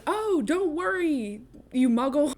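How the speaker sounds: high, slow, sing-song reassurance; cheery robed stranger. Retold or imitated. Robed stranger soothing Vernon, high, slow and sing-song. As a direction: sweetly talk down to the grumpy man. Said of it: "And the person says".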